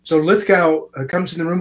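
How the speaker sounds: ordinary talk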